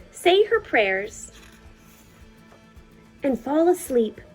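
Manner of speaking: ordinary delivery, nothing marked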